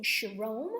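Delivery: chirome with an extra vowel, ch said as sh; mock mispronunciation chirome; chirome, the wrong way on purpose. Shown as a demonstration.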